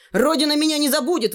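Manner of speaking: high, quick heroic cry; high-pitched grand exclamation; exclaiming. A narrator's reading. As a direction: proclaim it high, like last words of a hero. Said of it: "воскликнул он".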